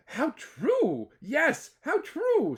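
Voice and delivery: high eager agreement; kangaroo agreeing. Acted. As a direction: agree brightly, high pitched. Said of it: "said the big kangaroo".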